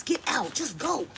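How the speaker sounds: high, urgent shooing of a spider; frightened shooing. Real, not acted. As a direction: plead with the spider to leave. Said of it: "see me act a fool".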